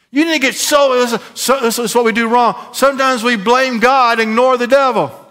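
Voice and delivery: high, driving preacher delivery; fired-up preaching. Acted. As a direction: preach it hard, urging the crowd on. Preaches at full force.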